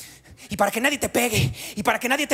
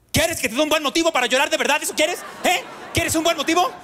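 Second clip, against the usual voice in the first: put-on higher, fast, scolding a crying kid; imitated furious parent; angry parent threat; 7 semitones higher, faster than usual. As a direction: rattle off the threat like a fed-up mom. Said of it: "Otra gran frase de colección".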